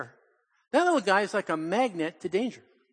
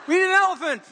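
much higher, louder showy declaration; with a flourish; 12 semitones higher, 7 dB louder, faster than usual. Retold or imitated. Granddaughter proclaiming the elephant plan, grand and high. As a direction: announce it grandly like a little girl. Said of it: "She said it with a flourish".

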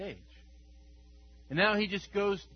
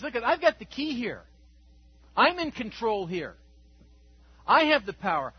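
louder, higher, declaring power; triumphant Christ; 6 semitones higher, 8 dB louder than usual. Acted. Preacher voicing Christ declaring authority over death, triumphant. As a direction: proclaim victory like a conqueror. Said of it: "He says".

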